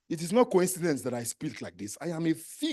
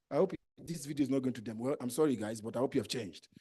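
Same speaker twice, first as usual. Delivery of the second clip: much softer, lower apologetic aside; half-apology under the breath; quiet aside; 6 semitones lower, 10 dB quieter than usual. Real, not acted. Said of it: "aside apology".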